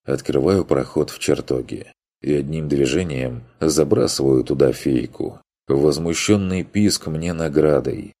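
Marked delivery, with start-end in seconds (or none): none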